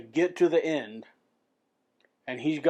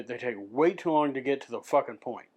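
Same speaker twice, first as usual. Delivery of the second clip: normal talking voice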